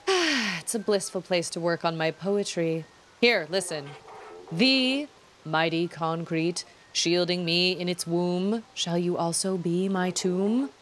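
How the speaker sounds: slow, drawn-out poetry recital; lightly affected solemn poet. Acted. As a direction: recite your own verse with grave self-importance.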